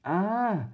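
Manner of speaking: sharp high startled "Ah"; a kid's scared little cry of shock; startled gasp. Retold or imitated. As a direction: yelp like you saw a monster. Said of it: "I kind of went".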